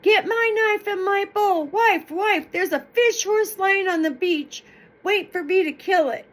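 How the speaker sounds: high, loud holler across the beach. Acted. Nephew hollering home to his wife, loud high call. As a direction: call it out to your wife far off. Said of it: "he starts a hollering".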